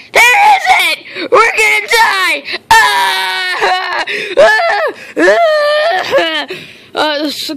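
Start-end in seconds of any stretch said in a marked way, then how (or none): 0.1-1.3 a panicked yelp of disbelief; high laughing shout. Acted. Repeated louder, higher. 1.3-6.5 mock panic. Panic screams over no food.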